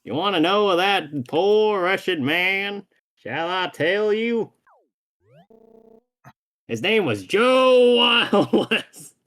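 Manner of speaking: Western drawl. Acted. Villain revealing the poor man's name, slow Western drawl. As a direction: build to a big, loud Western-accented reveal. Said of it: "the Western accent".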